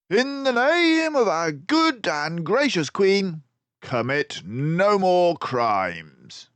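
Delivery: high, loud, commanding voice; stern detective. Acted. Basil naming and accusing the three crooks. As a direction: declare it like an officer of the law.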